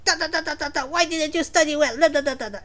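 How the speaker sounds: high rapid-fire nagging; mock angry scolding. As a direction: pretend telling-off in a stream of babble. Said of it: "he should be really angry and say".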